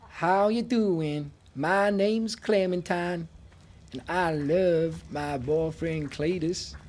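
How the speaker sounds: slow hammed-up Southern American drawl; mock American accent. Streamer mocking American accents for chat, slow Southern drawl. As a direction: ham up a hillbilly American girl. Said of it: "I automatically go for".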